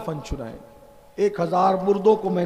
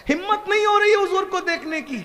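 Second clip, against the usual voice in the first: high, louder pleading cry; 11 semitones higher, 3 dB louder than usual. Acted. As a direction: plead it high and loud, near tears. Young man's plea voiced, preacher. (ऊँची, ज़ोरदार गिड़गिड़ाती पुकार)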